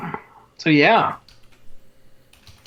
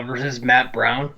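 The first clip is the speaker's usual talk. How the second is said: ordinary talk, nothing marked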